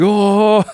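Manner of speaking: high, loud "oh" wail; mock cry of discomfort. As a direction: imitate a comic pained groan. Imitates Drax putting suit on.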